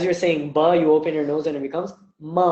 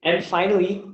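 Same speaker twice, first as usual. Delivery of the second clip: ordinary talk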